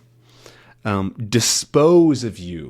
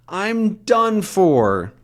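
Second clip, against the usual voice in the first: acted despair; 9 semitones higher, much slower than usual. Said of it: "he trips and he falls, saying".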